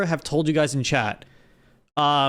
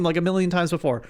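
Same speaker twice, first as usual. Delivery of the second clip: normal talking voice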